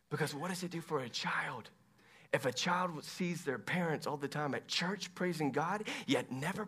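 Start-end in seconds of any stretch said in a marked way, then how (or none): none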